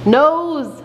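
"Nose!" called out high and bright; modeling the word. Shown as a demonstration. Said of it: "I want you to say nose".

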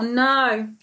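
ordinary delivery, nothing marked

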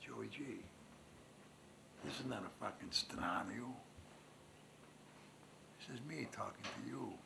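soft, urgent, near-whispered; hushed plea. Acted. Joey G begs his old friend for help, hushed. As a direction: lean in and plead quietly.

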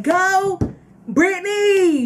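high mock cheer from the stands, slow; cheering parent. Imitating a parent cheering at a game, high mock cheer. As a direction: cheer like a proud mom at a game. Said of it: "they'll still be screaming".

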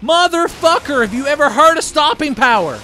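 high, loud outraged shout; furious yell. Real, not acted. Gamer raging at a zombie's haymaker. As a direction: rage at the punching zombie.

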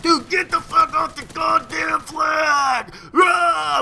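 slow, high hammed-up yell; mock scream. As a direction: yell at the flag-hogger, drawn out. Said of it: "I'm screaming and shit".

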